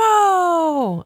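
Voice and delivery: high, drawn-out mock battle cry; playful yell. Co-host explains the fiesta to the host, playful high yell. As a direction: yell it high and long, just for fun. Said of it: "and yell".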